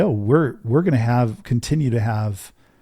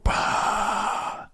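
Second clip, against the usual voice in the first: crowd roar; 5 dB quieter than usual. Retold or imitated. Imitating crowd cheer.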